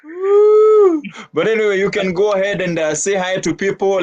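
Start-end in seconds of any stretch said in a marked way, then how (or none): 0.0-1.0 excited cheer. Real, not acted. Host cheers guests in.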